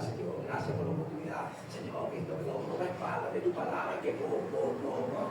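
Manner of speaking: breathy whispered plea to God; whispered prayer. Retold or imitated. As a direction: pray under your breath, pleading. Retold whispered prayer while crying.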